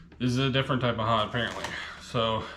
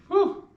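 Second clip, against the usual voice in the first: sharp high-pitched whew from spice heat; 3 dB louder than usual. Real, not acted. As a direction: blow out a high, loud whew. Reacting to spicy chip heat.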